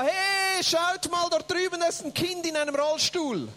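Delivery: high excited call; shouting. Retold or imitated. Interpreter as son spotting a child, excited shout. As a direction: call out loudly to the others. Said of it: "er schreit da".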